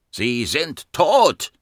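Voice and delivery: slow, high, drawn-out cry; roared. A narrator's reading. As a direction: roar it word by word, not at full volume. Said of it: "brüllte er". (langsam herausgebrüllt)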